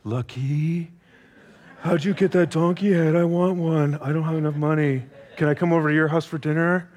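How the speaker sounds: lightly voiced, pleading, with a laugh; a mild mock beg for a donkey head; envious hungry neighbour. Preacher acts a starving neighbour begging for dinner, lightly pleading. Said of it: "you just go".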